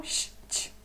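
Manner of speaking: sh, ch breathed with no voice; unvoiced sounds. Shown as a demonstration. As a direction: hiss sh, ch softly. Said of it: "No final".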